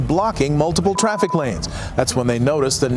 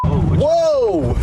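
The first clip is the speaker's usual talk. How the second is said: sharp, much higher cry of surprise; startled whoa; 11 semitones higher than usual. Real, not acted. Driver spotting the gunwoman on the bridge, shocked gasp. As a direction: caught off guard by what's ahead. Witness sees a shooting scene.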